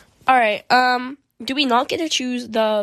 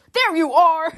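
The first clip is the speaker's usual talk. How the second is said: higher-pitched, hammed-up accusing call; finger-pointing dad; 5 semitones higher, 4 dB louder, slower than usual. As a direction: imitate a dad pointing you out emphatically. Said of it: "he's, like, pointing a finger".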